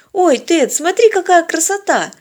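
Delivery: high, loud excited gasp of wonder; enchanted delight. A narrator's reading. Girl showing Ted a beautiful butterfly, enchanted. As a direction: exclaim in delighted awe. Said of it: "Девушка зачарованно прикипела взглядом".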